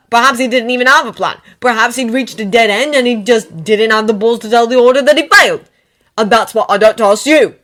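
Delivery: loud, fast at first, building rant; angry outburst. Acted. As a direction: pour out the distrust. Ron's bitter outburst.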